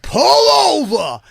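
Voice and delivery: high, loud, slow furious bark; cop shouting. Retold or imitated. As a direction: command the car to stop. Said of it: "he went".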